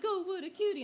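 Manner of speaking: high-pitched cooing at a cute little creature; mock baby-talk admiration from a scientist; condescending scientist. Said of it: "the little condescending scientist".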